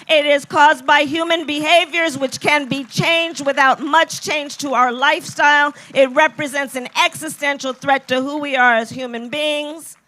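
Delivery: loud, punchy stump speech; rally speech. Acted. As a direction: fire up the crowd point by point. Rallying the crowd.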